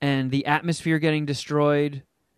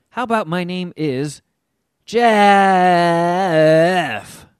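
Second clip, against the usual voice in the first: odd stilted robot voice; weird robot voice; 3 semitones higher, 6 dB louder, slower than usual. Retold or imitated. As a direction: say it like a clunky robot. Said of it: "and says it all weird".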